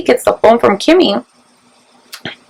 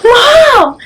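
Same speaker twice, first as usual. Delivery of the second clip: high, loud cry of Mom; retold scream; 13 semitones higher, 4 dB louder than usual. Daughter screaming for mom on the phone, loud high cry. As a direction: call out for your mom in a panic. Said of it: "She's screaming".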